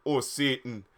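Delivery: understated put-on exclamation at normal pitch; mock pleasured cry. A narrator's reading. Harry calling out in pleasure, lightly mocked. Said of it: "yelled Harry in pleasure".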